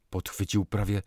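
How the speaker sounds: ordinary delivery, nothing marked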